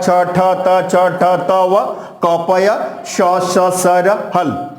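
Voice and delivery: careful chant of the sutras; measured teacher's recital, syllable by syllable; sutra recitation. Shown as a demonstration. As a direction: pronounce every syllable distinctly for students. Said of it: "आइए इसका उच्चारण सुनते हैं".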